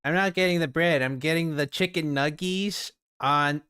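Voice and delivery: put-on voice parroting him, drawn out toward the end; mocking echo. Parroting the traveler's food order, mocking. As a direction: mimic him with exaggerated relish.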